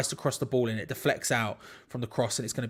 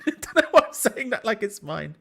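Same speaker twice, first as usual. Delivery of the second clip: high, loud laughing words; 8 semitones higher, 5 dB louder than usual. Real, not acted. Streamer amused at claiming his mum's vibe, laughing. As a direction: laugh right through the line at yourself.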